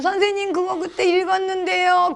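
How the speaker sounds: put-on high, whiny child's protest; high-pitched mock complaint; complaining pupil. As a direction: imitate a bored pupil objecting to teacher. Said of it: "계속 동화책만 읽으면".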